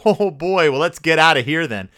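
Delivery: ordinary talk, nothing marked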